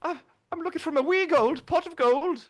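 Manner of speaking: playful high-pitched Irish leprechaun voice; Irish accent. Pretend. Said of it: "I even have a decent, a decent Irish accent".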